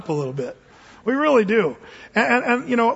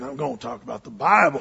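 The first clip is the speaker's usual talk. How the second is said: low-pitched imitation of a preacher; imitated low preacher rumble; grumbling preacher; 8 semitones lower than usual. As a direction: mumble it low, like the sermon opening. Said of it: "he kinda said it again".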